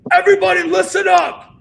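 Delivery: very loud, high bellow to a whole room; full-volume retold yell. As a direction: imitate a stage manager silencing a crowd. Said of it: "like, yelling".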